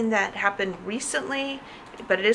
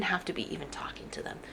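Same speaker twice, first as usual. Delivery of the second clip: whispered aside; 2 semitones lower, 9 dB quieter, faster than usual. Acted.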